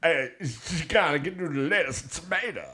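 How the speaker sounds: new silly voice. Retold or imitated. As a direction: slur it in a silly mock voice. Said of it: "So that's my new voice".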